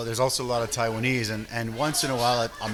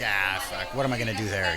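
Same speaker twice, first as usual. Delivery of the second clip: grumbling dad; 5 semitones lower than usual. Retold or imitated. Dad refusing son's invite to Taiwan, lower and grumbling.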